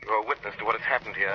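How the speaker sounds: usual speaking voice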